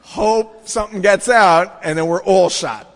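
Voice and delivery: slow, loud mock delight; sarcastic. Teller to friends about the drinking guard, slow loud sarcasm. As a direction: savor the sarcasm slowly. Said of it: "I'm like".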